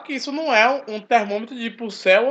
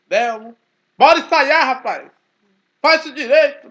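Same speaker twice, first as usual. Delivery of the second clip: calling out high and loud across the room; hollering at a friend to rehearse, louder; calling loudly; 5 semitones higher, 8 dB louder than usual. Retold or imitated. Youths calling him to rehearse.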